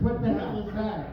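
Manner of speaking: usual speaking voice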